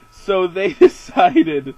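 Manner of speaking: breaking into laughter while talking; loud, slow, cracking up mid-sentence; laughing. Real, not acted. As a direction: laugh through the words, loud and drawn out. Cracking up at the party's plan.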